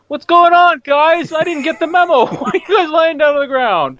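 high, puzzled goofy soldier; clueless soldier voice. Acted. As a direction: play the guy who missed the memo. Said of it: "is like".

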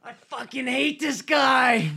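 high, slow, drawn-out yell; furious outburst. Acted. Dizzy venting about Glenn to the team, furious outburst. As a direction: let the hatred burst out in a high shout.

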